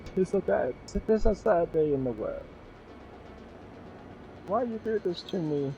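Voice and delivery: hammed-up high lament, "'Tis a sad day"; mock tragic dad. Dad mourning headphones dropped in the fire, hammed-up high lament. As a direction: wail like a ham actor mourning in old English. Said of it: "my dad was like".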